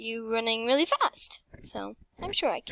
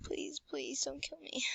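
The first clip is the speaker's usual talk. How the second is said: scared; 4 semitones higher, 10 dB quieter than usual. Real, not acted. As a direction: gasp then whispered plea. Fear during game.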